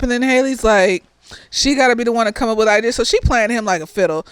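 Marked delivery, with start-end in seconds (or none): none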